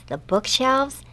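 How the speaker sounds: ordinary delivery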